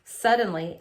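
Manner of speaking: usual speaking voice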